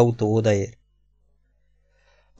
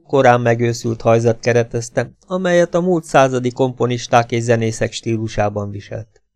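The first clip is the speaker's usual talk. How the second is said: ordinary delivery, nothing marked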